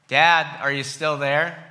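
put-on a scared boy calling from bed. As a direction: call for Dad into the dark. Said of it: "So I call out".

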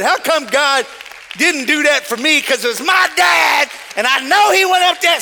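loud rising protest; a friend's hurt, loud question; Darren's complaint. Retold or imitated. Friend Darren asking why God skipped him, loud protest. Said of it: "And Darren said".

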